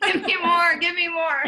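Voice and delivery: laughing plea. Retold or imitated. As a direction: beg with a laugh, hamming it up. Begging her boss, laughing.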